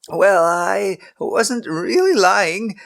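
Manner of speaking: high, slow, weak excuse; lame protest. A narrator's reading. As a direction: protest feebly, caught out. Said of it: "Abraham protested lamely".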